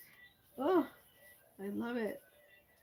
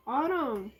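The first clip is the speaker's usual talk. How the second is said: ordinary delivery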